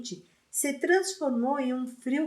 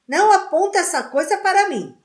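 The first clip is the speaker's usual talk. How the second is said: much louder and higher, fast; panicked shout; 9 semitones higher, 9 dB louder, faster than usual. A narrator's reading. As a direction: yell in fear at the wand. Dudley panics at the wand.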